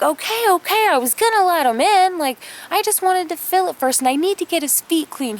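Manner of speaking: put-on a girl scrambling to calm her dad; defensive kid. Kim pleading with her angry dad, high and flustered. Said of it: "I'm like".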